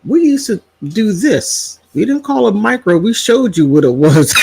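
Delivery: high-pitched, emphatic mom retort; imitated mother. Elderly mother corrects her daughter, emphatic imitation.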